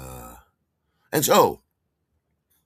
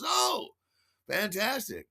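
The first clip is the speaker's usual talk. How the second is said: very high, swooping OH; impressed delight; 12 semitones higher, slower than usual. Retold or imitated. Man hearing he's talking to an actor, impressed. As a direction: coo it, thrilled.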